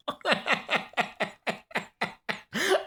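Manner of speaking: high wheezing giggle; breathy giggle. Real, not acted. Vlogger cracking up at own parody, high giggle. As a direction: giggle through it.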